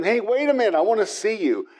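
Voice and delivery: high mock yell to a man down the street; calling after a stranger across the corner; retold yelling. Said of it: "I'm yelling at him".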